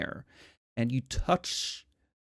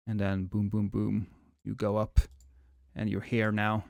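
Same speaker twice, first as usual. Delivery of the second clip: ordinary talk, nothing marked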